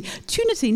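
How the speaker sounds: "Tunity." pitched high with rounded lips on "tu"; high isolated syllable; syllable demo. Shown as a demonstration. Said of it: "you need to have your lips sort of around".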